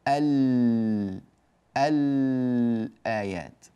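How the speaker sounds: الآيات slowly, with a long, audible ل; lam pronounced clearly. Shown as a demonstration. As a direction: hold the ل of ال before releasing الآيات. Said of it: "أظهرنا اللام فنق-، فقلنا".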